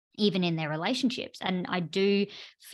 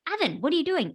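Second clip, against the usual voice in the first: a sudden, higher-pitched call of surprise at catching him; startled call; 8 semitones higher, faster than usual. Retold or imitated. Mum finding her son climbing in the wardrobe, startled sharp call. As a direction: blurt his name like you just walked in on him. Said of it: "I startled him and shocked him".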